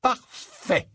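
high, delighted exclamation; elated confidence. A narrator's reading. Harry, brimming with potion-given confidence, elated. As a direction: say it through a big grin. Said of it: "il se leva avec un grand sourire".

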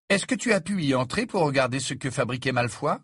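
ordinary delivery, nothing marked

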